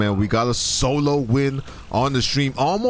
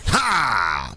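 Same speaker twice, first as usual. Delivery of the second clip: long falling "ha" cry; swooping falling yelp; exclamation; 5 semitones lower than usual. Real, not acted. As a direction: shout one drawn-out ha, sliding down in pitch. Exclaims during game play.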